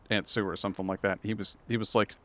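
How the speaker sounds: ordinary talk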